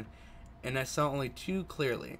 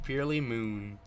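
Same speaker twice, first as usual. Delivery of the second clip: normal talking voice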